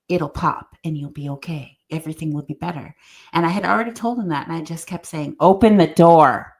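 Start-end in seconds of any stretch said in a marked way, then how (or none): 5.4-6.5 firm command. Retold or imitated.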